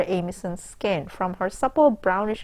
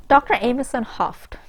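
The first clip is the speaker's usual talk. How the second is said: ordinary talk, nothing marked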